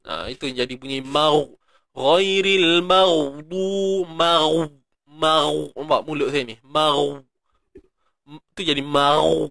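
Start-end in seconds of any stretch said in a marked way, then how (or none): 1.9-5.6 maghdu rounded to mau with pouted lips; lip-rounded ghain. Shown as a demonstration. Teacher demonstrating the common mistake. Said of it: "kita muncung bibir kat ghain".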